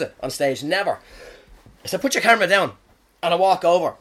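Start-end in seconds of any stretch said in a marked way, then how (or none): 2.0-2.8 louder, higher telling-off; retold angry order. Comic telling off girls filming him, angrily retold. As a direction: order it firmly, voice raised.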